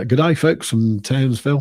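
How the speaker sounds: a light hammed-up Aussie greeting in an Aussie accent; half-hearted mock Aussie accent. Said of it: "me trying to do an Aussie accent".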